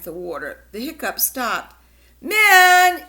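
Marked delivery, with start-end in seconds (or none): 2.2-3.0 calling out across the house, higher and much louder; yelling. Acted. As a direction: shout the name to a far room. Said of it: "yelled Mom".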